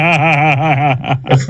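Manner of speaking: laughing. Real, not acted. Laughing at the absurd drive.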